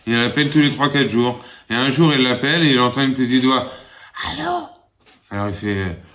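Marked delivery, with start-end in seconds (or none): 4.2-4.6 put-on tiny high child's "Allô?"; little child voice. Small boy answering the phone, very high child voice.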